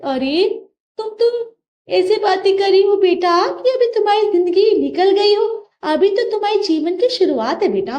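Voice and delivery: a lightly voiced mother soothing her girl; mother on the phone comforting her daughter; consoling mother.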